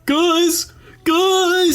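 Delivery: high desperate calls after friends; desperate calling. Pretend. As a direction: yell for them to wait up. Voicing Sweetums chasing the Muppets.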